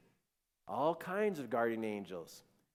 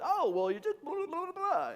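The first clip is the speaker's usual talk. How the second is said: high-pitched babbling stand-in voice; imitated angel voice; 5 dB louder than usual. Wife relays the angel's advice, imitated voice. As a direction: rattle off the gist in a high voice. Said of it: "but this voice goes".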